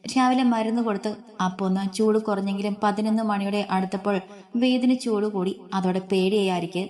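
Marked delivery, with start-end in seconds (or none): none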